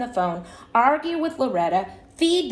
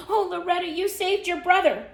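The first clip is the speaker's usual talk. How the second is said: high-pitched gushing praise; mother's delight; 8 semitones higher than usual. A narrator's reading. Mother greets Loretta after rescue, high delighted praise. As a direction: gush it high, like a thrilled mother.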